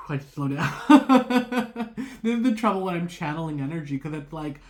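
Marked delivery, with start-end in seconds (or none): 0.8-2.2 a sudden high, loud laugh at herself; burst of laughter. Real, not acted. Tarot reader laughing at her own tangent, a loud burst. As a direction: laugh out, caught off guard by her own rambling.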